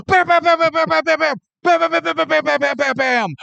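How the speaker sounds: spray imaginary bullets with your voice; cartoon machine-gun bams; mouth gunfire. Imitating gun emptying clip.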